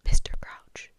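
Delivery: voiceless, airy eager address; breathless. A narrator's reading. Percy greeting his boss Mister Crouch, breathlessly. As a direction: whisper it as if short of breath. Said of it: "said Percy breathlessly".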